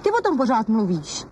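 normal talking voice

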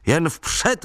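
high-pitched, merry rallying call; blithely upbeat call, not shouted; cheerful call. A narrator's reading. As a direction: cheer them onward, voice lifted. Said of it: "volal jsem vesele".

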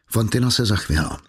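usual speaking voice

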